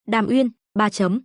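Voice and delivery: ordinary delivery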